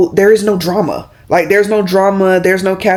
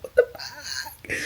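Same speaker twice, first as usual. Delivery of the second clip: slow stunned whispered laugh; shocked whisper; 8 semitones higher, 16 dB quieter, slower than usual. Retold or imitated. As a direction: breathe it out in disbelief. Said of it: "So I was like".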